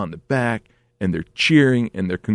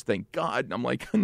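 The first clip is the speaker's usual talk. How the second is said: usual speaking voice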